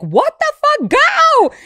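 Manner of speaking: very high, loud furious yell; road-rage scream. Acted. Driver alone in the car screaming at traffic, furious shriek. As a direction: scream it full out at the car ahead. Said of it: "I will fucking scream in the car".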